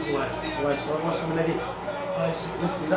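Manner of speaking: low, quiet nervous prayer; hushed worried fan; anxious murmur. Real, not acted. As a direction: pray under your breath in fear. Tense moment, Messi attacking.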